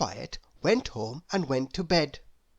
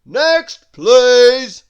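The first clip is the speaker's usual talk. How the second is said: high, very loud shopkeeper's call; big bellowed call; shouted; 6 semitones higher, 15 dB louder than usual. A narrator's reading. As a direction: shout for the next customer. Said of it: "shouted the grocer".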